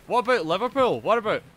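usual speaking voice

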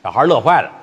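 ordinary delivery

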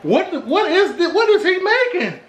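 high-pitched mock disbelief; puzzled viewer. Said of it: "Y'all probably looking at this like".

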